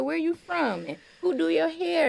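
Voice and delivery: a lightly voiced friendly hostess, high and eager; chatty lady asking. Kind lady asking the young guest about herself, voiced high and eager.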